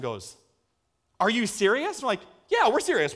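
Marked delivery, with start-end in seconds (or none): 1.2-2.0 imitated mom's raised-pitch question; incredulous but not yet shouting; mild disbelief. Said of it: "She was just beside herself". 2.5-3.1 high, slightly louder assurance; imitated eager yes, short of a shout; retold insistence. Reassuring the doubting mom, first time.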